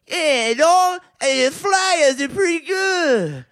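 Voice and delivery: loud, high Philly shout; Philly accent. Shown as a demonstration. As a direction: holler it in your best Philly accent. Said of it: "Can you do a Philly accent?".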